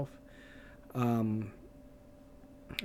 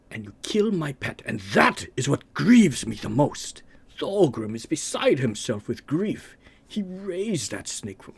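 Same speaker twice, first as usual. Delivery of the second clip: villain voice, rising anger; 3 semitones higher, 5 dB louder than usual. Retold or imitated.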